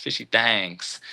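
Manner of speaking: "Thangs" said slowly with an A vowel; playful drawled pronunciation. Shown as a demonstration. Host repeating his play on a channel name, drawled "Thangs". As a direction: draw out "Thangs" to rhyme with "bangs". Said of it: "You see how I did that?".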